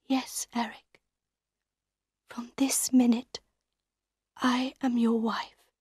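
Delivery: high, soft, breath-light surrender; very quiet. A narrator's reading. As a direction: give in very quietly, voice lifted. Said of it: "very quietly".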